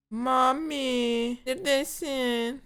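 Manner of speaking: high, drawn-out searching call. Real, not acted. Player calling Mami to ask where they are, sing-out call.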